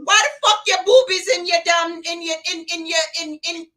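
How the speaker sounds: baffled, scolding Caribbean nurse; exasperated Caribbean carer, stumbling over words; Caribbean caregiver accent. Acted. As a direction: interrogate the patient in a comic Caribbean accent. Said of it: "permission to use this accent".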